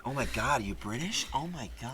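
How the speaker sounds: high-pitched American gushing; imitated American accent. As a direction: mimic an amazed New Yorker in an American accent. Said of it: "I went to New York".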